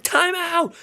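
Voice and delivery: high, shouted plea for a timeout; desperate shout. Retold or imitated. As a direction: beg the coach from the stands. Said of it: "I was like".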